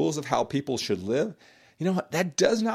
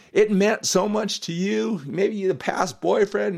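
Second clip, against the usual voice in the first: ordinary talk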